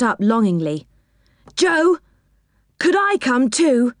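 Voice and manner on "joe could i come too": high, hopeful pleading; wistful, pitched-up child's request; begging. A narrator's reading. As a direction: plead to be taken along. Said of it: "he begged".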